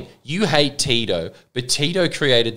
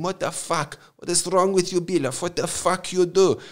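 soft, higher mock yell in Croatian accent; Croatian dad's mock yell; 5 semitones higher, 3 dB quieter than usual. Dad scolds the man who wrecked the sewers, understated Croatian-accented yell. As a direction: imitate a dad telling off a worker, Croatian accent, no real volume. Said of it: "I was yelling at him".

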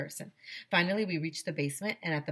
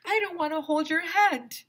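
put-on high, louder kid voice; high loud stubborn child; reluctant child; 13 semitones higher, 7 dB louder than usual. Character line in story.

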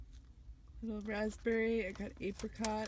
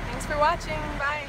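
bright sign-off, higher and louder; cheery loud outro, pitched up; 7 semitones higher, 13 dB louder than usual. Real, not acted. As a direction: wave the viewers goodbye with a big lift. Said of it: "signing off loudly".

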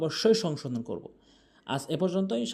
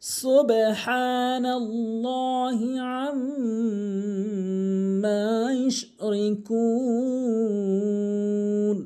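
slow, sustained chanting with long-held vowels; Quran recitation; 4 semitones higher, much slower than usual. Shown as a demonstration. Teacher opens the Quran lesson with a verse, slow melodic recitation. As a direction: recite it slowly and melodically, stretching each elongation.

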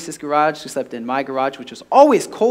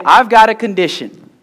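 loud, raised-pitch call to the congregation; loud prompt; 6 semitones higher, 8 dB louder than usual. Shown as a demonstration. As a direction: proclaim it for the crowd to repeat. Preacher prompts congregation to repeat.

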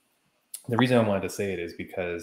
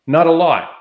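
loud burst; 9 semitones higher, 13 dB louder than usual. Real, not acted. Angry retelling of the grab.

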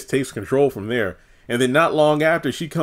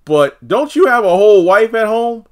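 higher, louder accusation; indignant call-out; 10 semitones higher, 9 dB louder than usual. Retold or imitated. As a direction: throw it at him loudly. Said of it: "I'm thinking to myself".

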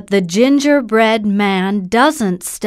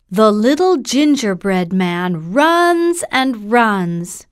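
usual speaking voice

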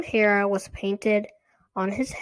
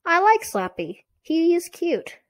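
raised pitch, a little louder; higher girlish insistence; 10 semitones higher, 4 dB louder than usual. A narrator's reading. Girl defends the dummy to her sister, higher insistent voice. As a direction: stick up for him in a higher voice.

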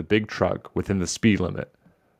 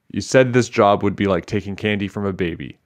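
plain reading voice

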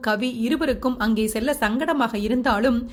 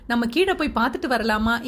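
ordinary delivery

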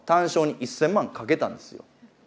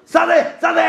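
mock shout from the old man; 10 semitones higher than usual. Old man suddenly yelling for his horse, mock high shout. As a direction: imitate the rival roaring. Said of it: "って言い出して".

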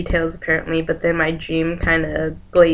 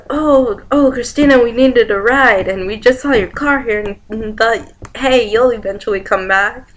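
higher-pitched pleading imitation; friends making excuses; 5 semitones higher, 5 dB louder than usual. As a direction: explain yourselves in a raised, acted voice. Friends' excuse acted after being yelled at.

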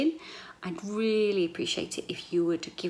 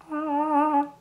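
echoey room sound; 9 semitones higher, 7 dB louder than usual. Shown as a demonstration. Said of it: "everything sounds like really like".

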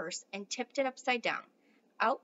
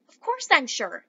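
put-on louder, eager outburst from the old man; excited splutter; 4 semitones higher, 9 dB louder than usual. A narrator's reading. Grandpa Joe insisting to Charlie, louder and excited. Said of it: "spluttered the old man excitedly".

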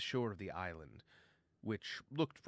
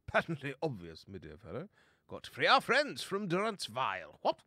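put-on blustering old-fashioned fellow, pitch leaping up; jolly gentlemanly chum; 4 semitones higher, 5 dB louder than usual. Bo tells Verg they must free their friends, jolly bluster. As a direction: declare it like a confident old chap.

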